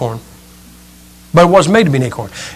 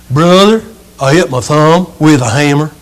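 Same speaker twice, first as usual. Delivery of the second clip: put-on Southern accent. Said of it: "He's from the South".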